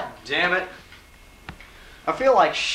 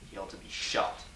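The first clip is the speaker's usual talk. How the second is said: muttered aside; 7 semitones lower, 13 dB quieter than usual. Acted. Muttered aside about cameraman.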